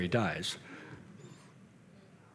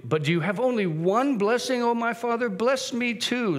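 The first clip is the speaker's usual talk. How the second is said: acted pleading; 9 semitones higher than usual. Cheated son begging his father for a blessing, pleading. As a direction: plead upward, stretching the last words. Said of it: "Esau pleaded".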